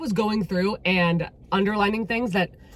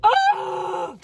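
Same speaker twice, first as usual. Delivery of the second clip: high, loud wail of dismay; hammed-up anguished outcry; anguished cry; 13 semitones higher, 3 dB louder than usual. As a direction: cry out as if stabbed by the plot. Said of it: "I'm like".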